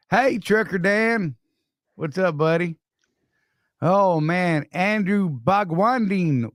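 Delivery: ordinary talk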